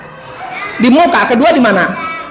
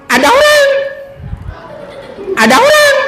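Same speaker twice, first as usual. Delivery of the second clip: squawking goose; 13 semitones higher than usual. Acted. The goose warns the house that someone is coming, a high squawking goose. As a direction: honk it out like an alarmed goose.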